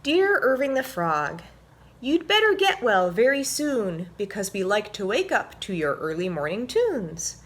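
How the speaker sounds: animated picture-book read-aloud; reading to children. A narrator's reading. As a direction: read it like story time for little ones.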